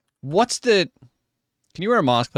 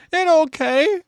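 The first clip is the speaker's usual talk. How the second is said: high-pitched mock tearful comforting; Matt Damon; 13 semitones higher, much slower than usual. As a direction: imitate a weepy movie-star pilot, breaking into laughter at the end. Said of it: "Matt Damon played a pilot in thirty Rock".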